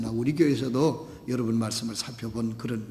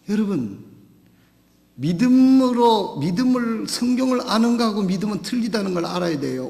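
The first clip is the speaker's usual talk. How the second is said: higher, louder, driving the point home; preaching at full force; 6 semitones higher, 5 dB louder than usual. Acted. Pastor exhorting the congregation, preaching hard. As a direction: pound each phrase out to the congregation.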